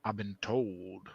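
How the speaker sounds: slow, soft Southern reading; attempted Southern accent. Reader starting a story on a friend's request, attempted Southern accent. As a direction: draw it out softly in Southern US.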